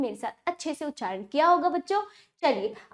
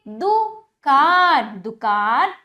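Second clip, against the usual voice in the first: दुकान slow and loud, split then blended; loud, drawn-out drill on दुकान; broken, joined pronunciation; 5 dB louder, much slower than usual. Shown as a demonstration. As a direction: stretch दु and कान, then join दुकान. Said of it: "तोड़कर जोड़कर उच्चारण".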